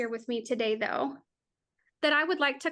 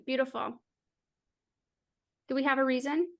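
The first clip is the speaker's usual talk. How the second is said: usual speaking voice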